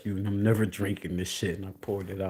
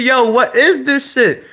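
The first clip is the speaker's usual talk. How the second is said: high, very loud mock outrage; retold outraged shout; 18 dB louder than usual. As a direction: demand it loudly, acting out the shock. Acted argument with child.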